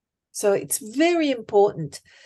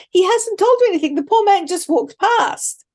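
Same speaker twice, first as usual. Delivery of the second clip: ordinary talk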